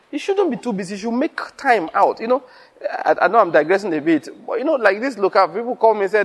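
usual speaking voice

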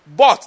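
But hit hard and loud; stress on But. Shown as a demonstration. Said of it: "why am I emphasizing that".

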